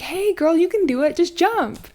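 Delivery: high, loud encouraging cheer; mock cheering friends. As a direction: hype her up to jump. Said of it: "they're all like".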